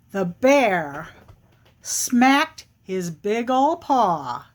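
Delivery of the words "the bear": ordinary delivery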